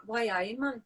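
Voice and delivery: a light Geordie "Why aye, man"; Geordie accent. Retold or imitated. Explaining Geordie speech, a light Geordie accent. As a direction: toss off the Geordie catchphrase. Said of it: "Geordie's like".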